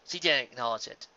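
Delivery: usual speaking voice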